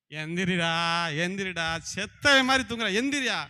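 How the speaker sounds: acted yelling at a sleeper; exasperated wake-up call. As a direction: rouse someone sleeping like the dead. Acting out rousing a deep sleeper.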